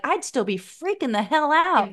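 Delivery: ordinary delivery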